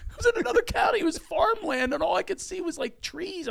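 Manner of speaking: high, fast laughing telling. Real, not acted. Laughing as he tells how far away he landed. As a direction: laugh through the words.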